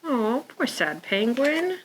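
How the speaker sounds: ordinary delivery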